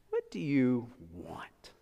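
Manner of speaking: slow and hushed, landing on "want"; stress on WANT. Shown as a demonstration. Pastor showing where the emphasis goes, stress on WANT. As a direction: set "want" apart, low-key and drawn out. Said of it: "that's where the emphasis goes".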